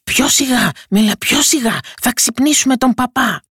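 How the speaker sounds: high, urgent pleading; alarmed robbers. A narrator's reading. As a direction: beg him in a mild panic. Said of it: "Οι ληστές τρόμαξαν και του λένε".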